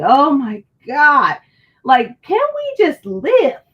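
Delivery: loud, slow exasperated plea. Real, not acted.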